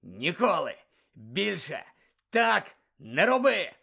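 slow, rising to a loud high bark; stern scolding. Acted. Moody bouncing the ferret, slow furious scolding. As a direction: scold each word like a blow. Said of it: "примовляв Муді".

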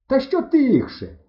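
high, slow, stretched yell; indignant shout. A narrator's reading. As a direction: shout back in protest. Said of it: "кричу я". (обурений крик)

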